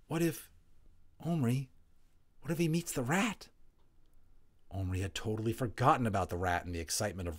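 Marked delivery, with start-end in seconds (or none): 0.1-3.5 higher, anxious question; a boy suddenly fearing for the tiny man; worried. A narrator's reading. Patrick asks Omri in sudden fear, higher and anxious.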